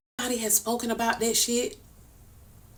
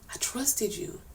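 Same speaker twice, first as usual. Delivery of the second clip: whispered, much softer; hurt whisper; 3 semitones higher, 11 dB quieter, slower than usual. Real, not acted. Telling Nitra how deeply she trusted her, whispered. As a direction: breathe it out, wounded.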